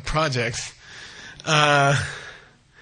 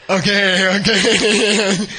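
higher, louder, breaking into laughter; laughing assent; 4 semitones higher, 5 dB louder, much slower than usual. Acted. Logician gives in to the goddess, laughing helplessly. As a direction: laugh all through the words.

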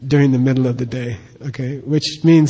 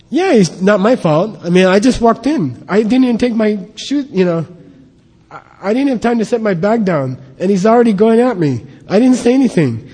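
higher-pitched defensive whine of excuses; higher, aggrieved mock complaint; devil's self-justifying voice; 5 semitones higher than usual. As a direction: protest your innocence as the blaming inner devil. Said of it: "that's the devil talking".

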